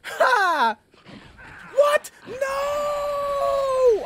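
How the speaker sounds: very high, loud wail of disbelief; gasp, then scream out in dismay; shocked shout. Real, not acted. Hit by a trap in game.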